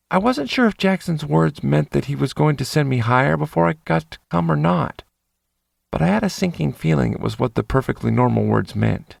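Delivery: ordinary delivery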